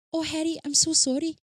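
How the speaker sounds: high, giggly Scottish lilt; Scottish accent. Pretend. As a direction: play Cho with a Scottish accent. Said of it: "I can do a really good Cho accent".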